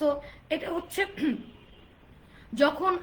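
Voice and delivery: ordinary delivery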